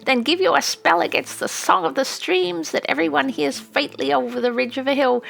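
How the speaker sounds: put-on an old witch passionately defending magic and sending them off; fierce old witch.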